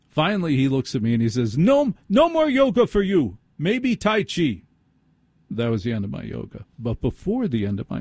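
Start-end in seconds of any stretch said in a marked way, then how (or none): 1.6-4.6 high-pitched comic German verdict; mock German accent. Surgeon banning yoga for the patient, put-on high German accent. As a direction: hand down a ruling in a fake, squeaky German accent. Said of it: "yelling at me with a German accent".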